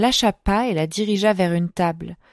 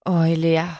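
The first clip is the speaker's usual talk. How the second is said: lightly growled murmur of desire; 2 semitones lower than usual. A narrator's reading. Knight to Eléa after the kiss, growled. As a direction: growl her name gently. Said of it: "gronda-t-il".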